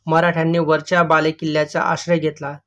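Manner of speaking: ordinary talk, nothing marked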